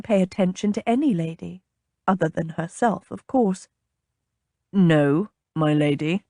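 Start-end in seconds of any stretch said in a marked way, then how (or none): 4.7-6.2 low, restrained exasperation; exasperated captain. A narrator's reading. Captain denying his lady's suggestion, exasperated. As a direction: bite it out in a lower voice. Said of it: "sounding exasperated".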